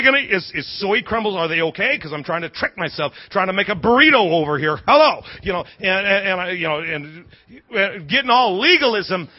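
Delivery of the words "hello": calling out. Real, not acted. Calling out to the crowd.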